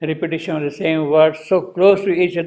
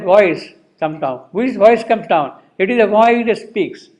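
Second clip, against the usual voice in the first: "voice" driven home, pitched up; stress on "voice"; 5 semitones higher, 4 dB louder than usual. Shown as a demonstration. Teacher showing what the poet emphasizes, "voice" stressed high. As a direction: emphatically point up "voice" in every phrase. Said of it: "he is rather emphasizing what is that".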